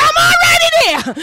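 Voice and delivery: high, loud proclamation; triumphant shout. Acted. Preacher voicing God's answer to the church, shouting. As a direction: ring it out in triumph.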